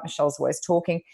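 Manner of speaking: ordinary delivery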